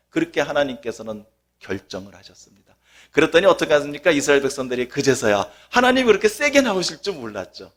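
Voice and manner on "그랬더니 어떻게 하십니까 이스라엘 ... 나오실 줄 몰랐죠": usual speaking voice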